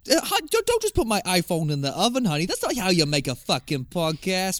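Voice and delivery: high-pitched British scolding; British accent. Acted. Husband scolding honey over iPhone in oven, British accent. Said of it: "I'm still going into the British accent".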